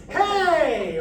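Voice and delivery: high, loud mixed call; strong chesty mixed call; powerful calling voice. Shown as a demonstration. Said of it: "there's more power than the head voice".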